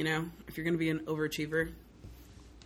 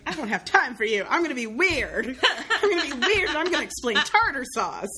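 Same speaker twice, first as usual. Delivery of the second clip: louder, higher, laughing through the boast; laughing, playful defiance; 7 semitones higher, 6 dB louder than usual. Retold or imitated. Teen forensics kid boasting to her rival, laughing loud. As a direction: cackle out your own cheeky brag. Retold self in monologue rivalry.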